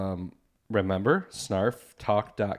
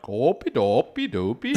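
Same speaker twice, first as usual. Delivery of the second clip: singsong mock Norwegian accent. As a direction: tease Iowans with a silly mock Norwegian lilt. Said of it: "they're all like Norwegians".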